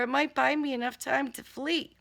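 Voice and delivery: plain reading voice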